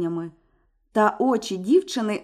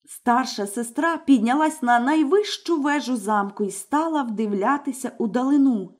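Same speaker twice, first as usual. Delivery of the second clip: usual speaking voice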